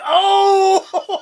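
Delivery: high, loud whoop; burn reaction. Retold or imitated. Reacting to Thea's comeback with co-host, high laughing whoop.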